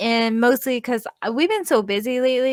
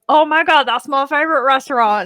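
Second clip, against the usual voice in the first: louder, higher goofy imitation; hammed-up goofy impression of the co-host; 3 semitones higher, 6 dB louder, faster than usual. Woman quotes co-host on the shared restaurant, goofy voice. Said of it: "I'm being goofy voice".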